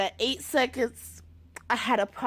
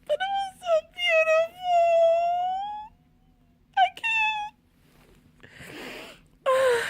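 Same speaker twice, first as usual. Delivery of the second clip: crying. Real, not acted. Overwhelmed by the trailer, crying.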